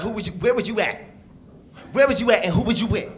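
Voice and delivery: hammed-up jealous girlfriend; imitating his ex. Comic as his ex accusing him, high mock jealous voice. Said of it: "she would talk like this".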